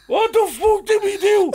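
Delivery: put-on high, loud, slow drunk panic; panicked drunk mate. Friend waking in Garda car, high slow panicked voice.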